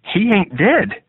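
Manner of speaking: high, slow pleading protest. A narrator's reading. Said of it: "his friend protested".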